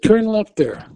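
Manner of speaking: high, loud retold order; urgent command. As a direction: snap the turn out.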